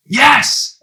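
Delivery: high, loud Yes from a screaming crowd. A narrator's reading. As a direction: fans scream their answer. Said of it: "they all screamed".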